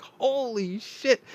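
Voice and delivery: high, slow, drawn-out "holy shit"; amazed exclamation. Retold or imitated. Realizing he drank a liter, a high, slow, stunned exclamation. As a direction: stretch it out in stunned disbelief. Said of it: "I was like".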